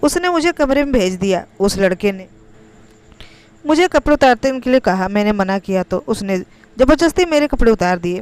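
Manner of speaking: ordinary delivery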